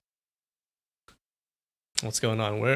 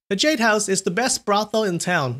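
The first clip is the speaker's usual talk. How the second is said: higher, playful grin; grinning woman voice; 7 semitones higher than usual. A narrator's reading. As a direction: tease her with the answer. Friend answers with a grin.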